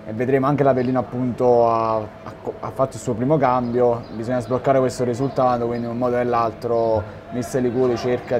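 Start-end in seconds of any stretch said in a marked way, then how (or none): none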